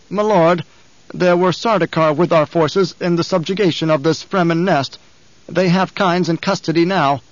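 a frightened underling hurrying to please; anxious guard captain. Acted. Captain explains Sardaukar.

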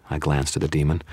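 usual speaking voice

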